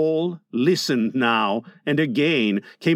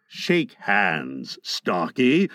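menacingly pleasant; 8 semitones lower, much slower than usual. A narrator's reading. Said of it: "more pleasantly than ever".

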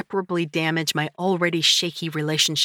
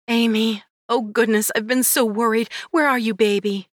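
higher, louder anxious mom; worried mother; 6 semitones higher, 4 dB louder than usual. Acted. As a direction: rush to her in relief. Worried mother answers phone.

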